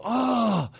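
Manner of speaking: high, drawn-out "oh"; retold high groan of dismay; exasperated groan. Guest hearing clerk wants her manager, exasperated groan. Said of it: "And I go".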